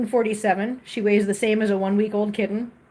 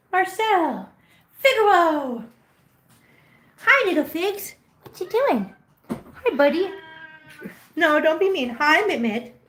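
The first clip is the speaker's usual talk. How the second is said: cooing pet voice; 6 semitones higher than usual. Real, not acted. Owner greeting her cats in high cooing baby talk. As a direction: coo at the kittens like a doting owner.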